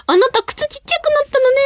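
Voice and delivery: high, singled-out voice for the staffer; costume staffer quote. Retold or imitated. Wardrobe staffer remarking to the performer, high imitated voice. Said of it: "なんて言われたんですよ、 衣装さんに".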